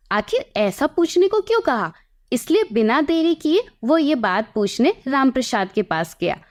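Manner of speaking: usual speaking voice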